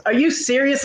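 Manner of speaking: high-pitched mock teen disbelief; incredulous daughter. Daughter protests in quarrel.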